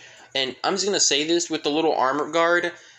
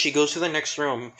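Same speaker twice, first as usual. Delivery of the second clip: ordinary delivery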